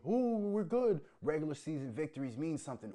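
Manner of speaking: high and loud, gushing mock fan voice; mocking smug fan. Streamer mocks Bengals fans bragging, high loud mockery. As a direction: crow it like a giddy fan, then settle. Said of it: "they have the right to say".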